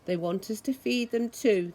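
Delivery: ordinary delivery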